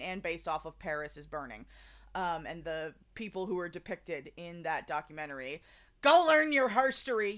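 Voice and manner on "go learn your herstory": louder, higher push to go learn; rallying call. Real, not acted. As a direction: cheer them on to learn history. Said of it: "urging viewers".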